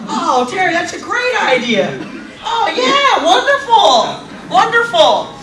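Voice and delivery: high, gushing approval; mock delight. Director approves Terry's great idea, mock delight. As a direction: gush in a put-on high voice, stretching the praise.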